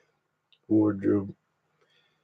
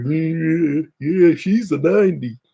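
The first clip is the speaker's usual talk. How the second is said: higher, lilting mocking voice; mock sing-song agreement; 7 semitones higher, slower than usual. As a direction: tease in a high sing-song. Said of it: "It's like".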